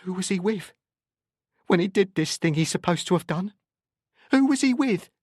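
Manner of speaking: high-pitched accusing questions. A narrator's reading. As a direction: demand an answer, rising in pitch. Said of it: "recover some composure".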